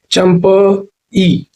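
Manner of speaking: very slow Champai, champa, then a separate ee; syllable by syllable. Shown as a demonstration. Teacher drilling the adjective, Champai slowly broken apart. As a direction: hold and split Champai before the final ई.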